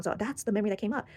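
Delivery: usual speaking voice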